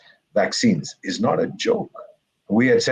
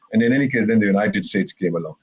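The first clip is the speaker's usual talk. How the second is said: ordinary delivery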